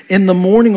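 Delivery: "In the morning" lifted high and loud; emphasis on MORNING. Acted. Preacher reading David's morning psalm, emphatic on "morning". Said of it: "you notice the emphasis on the morning".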